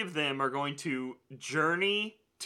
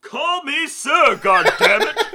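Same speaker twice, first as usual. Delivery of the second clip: high, very loud, drawn-out movie impression; Cameron; 10 semitones higher, 12 dB louder, much slower than usual. Podcaster playing along with co-host's comparison, high shouted impression. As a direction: bellow it as a strained, pitched-up outburst. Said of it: "uh, Cameron".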